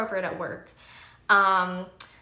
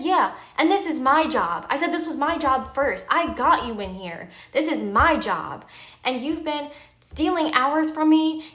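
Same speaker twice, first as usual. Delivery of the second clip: loud, higher-pitched, emphatic retold argument; indignant retort; 5 semitones higher, 6 dB louder than usual. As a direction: stake your claim forcefully, punching key words. Retold argument over her job.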